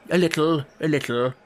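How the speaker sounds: mildly nervous repetition; rather nervous. A narrator's reading. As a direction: answer with a touch of unease. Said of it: "rather nervously".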